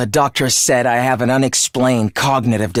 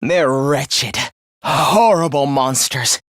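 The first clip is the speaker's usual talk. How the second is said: low, deliberate denunciation; low-pitched, weighty disgust; dark contempt; 6 semitones lower, slower than usual. Acted. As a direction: spell out humanity's sins with low loathing. Villain denounces humanity.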